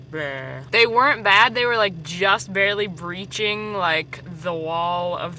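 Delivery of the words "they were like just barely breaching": ordinary talk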